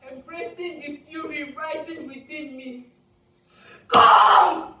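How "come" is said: full-force, high-pitched yell; one huge, furious cry daring them closer; defiant shout. A narrator's reading. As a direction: shout a challenge at approaching attackers. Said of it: "I shout as they move closer".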